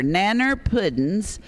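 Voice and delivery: "Nanner" drawn out, first syllable of banana dropped; folksy pronunciation. Real, not acted. Auctioneer repeating the better dessert name, folksy "Nanner". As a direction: say "Nanner" slowly, as a homely short banana. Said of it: "That's even better".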